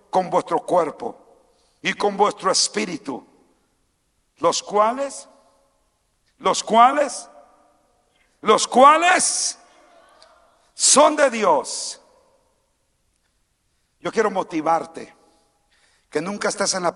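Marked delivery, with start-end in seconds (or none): 4.4-12.0 "los cuales" repeated, rising higher and louder, then "son de Dios" very slow; building repetition. Acted.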